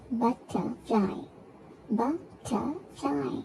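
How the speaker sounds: "Butterfly" slow, in three separate beats; syllable by syllable. Shown as a demonstration. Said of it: "three syllables".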